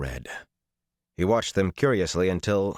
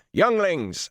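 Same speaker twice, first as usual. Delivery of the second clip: shouted rallying cry; 12 semitones higher than usual. A narrator's reading. Riders galloping away to gather their men, shouting. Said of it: "all the others shouting".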